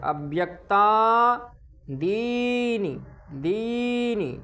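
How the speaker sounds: अव्यक्तादीनि drawn out slowly, दी with a long ई; careful slow recitation; long ii in dinii. Shown as a demonstration. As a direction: stretch the दी in अव्यक्तादीनि. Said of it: "छोटी ई है और दी बड़ी ई है".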